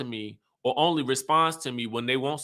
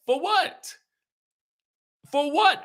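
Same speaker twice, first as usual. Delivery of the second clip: incredulous; slower than usual. Real, not acted. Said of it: "arguing with Robin on screen".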